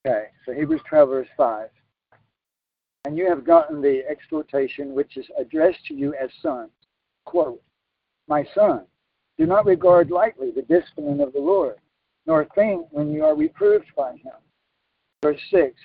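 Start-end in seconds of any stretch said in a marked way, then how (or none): 3.1-14.4 loud, raised scripture reading. Real, not acted. Preacher reads Hebrews to the congregation, loud scripture reading. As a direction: proclaim the verse at full voice.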